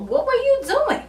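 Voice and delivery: high-pitched exasperated disbelief; incredulous scolding. Acted. Fan calling out Jamal for blocking bullets, high incredulous scolding. Exclaiming at Jamal's foolish move.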